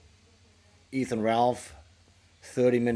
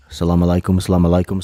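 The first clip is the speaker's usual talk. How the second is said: loud, lower mock Arabic greeting; 4 semitones lower, 13 dB louder, faster than usual. Streamer greets chat with a loud mock salam. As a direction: boom the greeting out.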